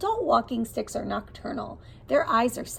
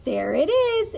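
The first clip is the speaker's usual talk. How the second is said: higher, louder "there it is"; higher, louder storytime find; excited find; 9 semitones higher, 5 dB louder than usual. Acted. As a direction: pounce on the answer with delight. Finding the hidden butterfly.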